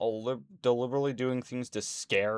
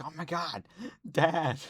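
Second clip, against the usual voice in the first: put-on scared kid; 6 semitones higher than usual. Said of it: "She's like".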